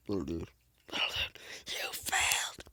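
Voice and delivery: unvoiced whispered taunt. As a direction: breathe it like a secret.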